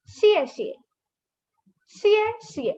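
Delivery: "Xie xie" starting high and dropping suddenly; fourth tone. Shown as a demonstration. Teacher repeats thank you, high falling "Xie xie". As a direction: let "Xie xie" fall away sharply. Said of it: "these both are fourth tone".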